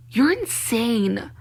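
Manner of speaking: drawn-out, very slow; slowly, stunned at them; slow disbelief. Real, not acted. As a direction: let each word land in disbelief. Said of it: "disbelief at them".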